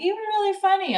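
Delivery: high-pitched mimicked compliment; imitated gushing girls. Girls stopping the comic on her way out, imitated high and gushing.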